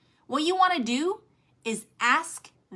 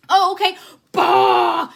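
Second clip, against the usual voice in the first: higher, louder eager burst of info; 7 semitones higher, 11 dB louder, slower than usual. Retold or imitated. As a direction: blast them like a fire hose. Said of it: "And you're like".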